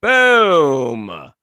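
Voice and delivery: long, falling "boom"; supply the blast with a drawn-out boom; voiced explosion noise. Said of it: "There's no sound, so".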